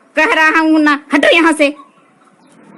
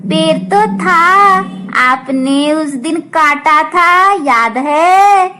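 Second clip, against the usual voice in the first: put-on high, pointed child voice; a hint of a child's reproach; reproachful child; 3 semitones higher, 5 dB louder, slower than usual. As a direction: slowly remind the uncle what he did. Child reminds uncle.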